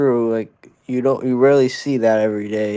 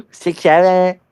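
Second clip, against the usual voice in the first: meme callout; 6 semitones higher, 4 dB louder than usual. Pretend. Six seven meme callout.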